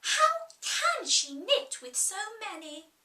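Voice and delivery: put-on a curious child musing to herself; Alice, puzzled.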